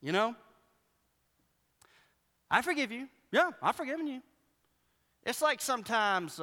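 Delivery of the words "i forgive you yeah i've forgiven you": higher, mock sulky I forgive you; pouty sarcastic forgiveness; grudging forgiveness. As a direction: say I forgive you like you are still mad. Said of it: "when we're mad".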